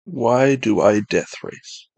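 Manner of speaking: ordinary delivery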